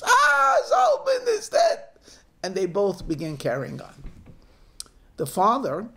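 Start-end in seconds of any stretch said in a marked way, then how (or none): 0.0-1.8 very high, loud shriek of grief. Acted. Mother realising grandson might die, grief-stricken shriek. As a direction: scream out the terrible news. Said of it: "and she shrieks".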